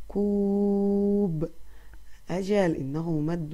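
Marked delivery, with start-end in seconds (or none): 0.1-1.5 كوب with the oo drawn out very slowly; slow sounding out. Shown as a demonstration. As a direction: stretch كوب so the oo rings long. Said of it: "هيا لنلفظها ونعرف".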